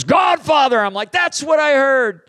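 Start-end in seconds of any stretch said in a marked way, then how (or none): 0.0-0.8 higher, louder insistent spirit voice; the demon insists on the word; spirit's reply. Retold or imitated. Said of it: "And the Spirit goes". 1.1-2.2 higher, louder cry of recognition; excited shout. Retold or imitated. Stunned preacher confirms the word.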